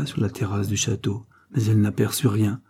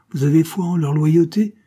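raised, scornful question; disbelieving, pitched up; incredulous challenge; 6 semitones higher, 4 dB louder than usual. A narrator's reading. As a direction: challenge their naive trust.

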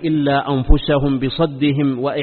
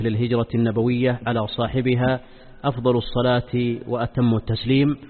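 solemn, measured sermon opening; formal standard arabic; 4 semitones lower, 6 dB quieter than usual. Acted. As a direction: recite the opening praise formally. Formal opening praise formula. (افتتاحية خطبة رسمية هادئة)

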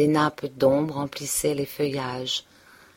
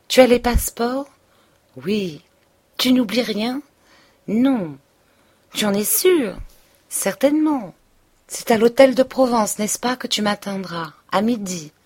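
higher, brighter eager questions; cheerful; 5 semitones higher, 4 dB louder than usual. A narrator's reading. Emma quizzing Rodolphe on the escape, higher and cheerful. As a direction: check the plans gaily. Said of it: "prenant un air gai".

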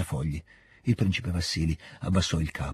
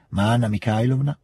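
usual speaking voice

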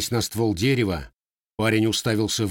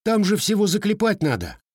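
higher youthful voice; boyish complaint; 11 semitones higher than usual. A narrator's reading. Grandson complains about the repair, youthful. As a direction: complain like a teenage boy.

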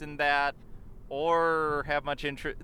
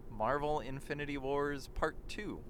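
normal talking voice

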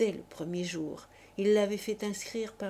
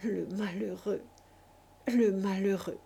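stammering grief. A narrator's reading. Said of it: "pendant qu'il bégayait".

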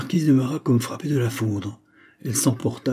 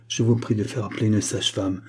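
usual speaking voice

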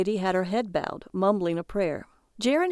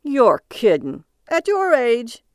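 slow, loud, higher incredulous cabbie; a cab driver scoffing at a grown man who can't drive; booming slow gruff-cabbie disbelief; cabbie's disbelief; 4 semitones higher, 7 dB louder, slower than usual. Acted. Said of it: "The cabbie turned around".

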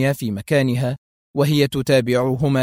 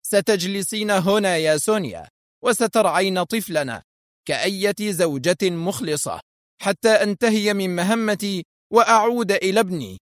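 raised-pitch, commanding orders; angry sternness; 7 semitones higher than usual. A narrator's reading. Husband orders wife to mind their child, angrily stern. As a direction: order her sternly, anger held in. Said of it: "بنفس الصرامة الغاضبة".

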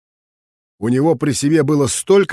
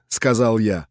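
ordinary delivery, nothing marked

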